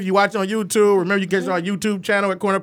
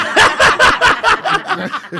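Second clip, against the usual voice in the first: loud high-pitched laugh bursting out; high burst of laughter; 12 semitones higher, 4 dB louder than usual. Real, not acted. Host cracking up at the joke, loud high laugh. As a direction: let a big high laugh break out.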